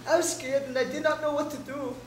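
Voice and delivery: ordinary talk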